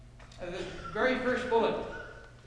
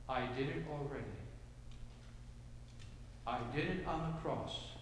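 low, hushed voice as Jesus; quiet voice of Jesus; 7 semitones lower, 10 dB quieter than usual. Acted. Preacher voicing Jesus to the congregation, low and hushed. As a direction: speak softly as the risen Lord.

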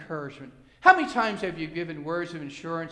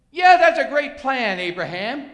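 loud, slow, higher-pitched fake endorsement; mock approval; 5 semitones higher, 9 dB louder than usual. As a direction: exaggerate the approval before knocking it down. Mock reply from God, then denied.

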